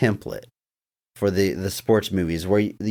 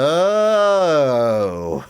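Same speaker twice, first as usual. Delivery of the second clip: long drawn-out, louder "no"; Carp's deadpan no; 5 semitones higher, 5 dB louder than usual. Retold or imitated. Co-host quoting the kid's comeback in the movie, drawn-out mock no. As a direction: stretch one word out, loud, then let it sink. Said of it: "And Carp goes".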